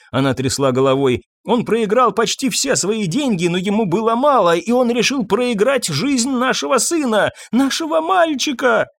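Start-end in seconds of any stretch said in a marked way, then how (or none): 1.4-8.9 high, anguished tirade climbing at the end; distraught mother on the edge of sobs; hysterical accusation. A narrator's reading. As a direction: build the accusation up to a high cry. Mother accuses, then breaks into sobs.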